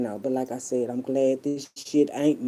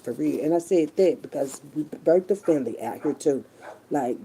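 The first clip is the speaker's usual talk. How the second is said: ordinary talk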